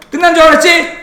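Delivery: high, playful gotcha; spring it on them in a high voice; teasing gotcha. Pretend. Teacher springing another item on class, teasing gotcha. Mock gotcha as list keeps growing.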